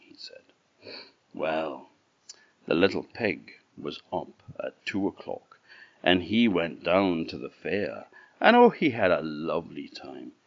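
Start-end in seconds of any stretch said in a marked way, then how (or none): none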